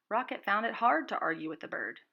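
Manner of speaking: ordinary delivery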